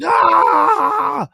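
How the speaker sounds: short high yelled scream; retold scream. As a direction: let out a sharp scream of pain.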